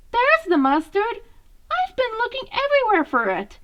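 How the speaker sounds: triumphant little brother who found it; excited find. Acted. Mikey excitedly finds mustard.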